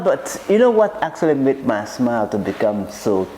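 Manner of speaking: usual speaking voice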